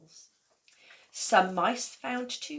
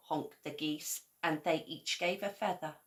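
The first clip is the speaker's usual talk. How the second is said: usual speaking voice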